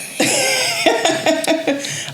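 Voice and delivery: high burst of laughter; real laughter. Real, not acted. Interviewer laughing at the joke that he's about to cry.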